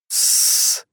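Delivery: drawn-out shushing hiss; long hiss. A narrator's reading. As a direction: hold a long hush. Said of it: "шипел Олег".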